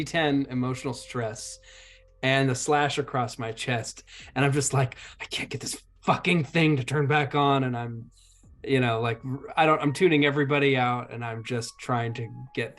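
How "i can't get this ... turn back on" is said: normal talking voice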